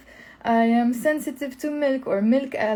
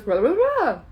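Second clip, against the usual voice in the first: high-swooping gibberish, confidently wrong; mock a pupil who can't repeat it, pitch leaping up; Joey's garbled French; 5 semitones higher, 3 dB louder than usual. Joey failing Phoebe's French lesson, loud garbled gibberish. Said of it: "and then he goes".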